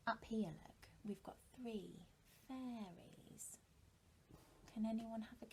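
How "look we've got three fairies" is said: soft whisper, pointing something out; hushed whisper. Acted. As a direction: whisper it like a secret clue. Teacher points out hidden clues.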